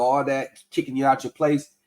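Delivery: ordinary talk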